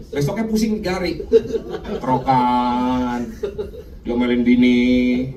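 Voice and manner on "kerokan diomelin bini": low, drawn-out drone; droning hungover recital. Acted. Preacher lists the morning-after woes to the congregation, droning low and slow. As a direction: drag each item out low and monotone. Acting out the day after drugs.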